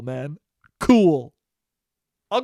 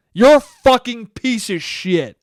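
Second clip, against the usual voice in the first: louder, higher, drawn-out mock fury; angry insult; 4 semitones higher, 7 dB louder, slower than usual. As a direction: hurl it slowly as an exaggerated attack. Acted attacker answers the fan.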